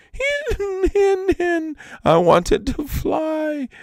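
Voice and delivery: very high, slow wailing sob; a high, drawn-out whimpering lament; weeping. Acted. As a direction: wail it out like a crying child. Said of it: "Iktomi's tears were like a spring shower".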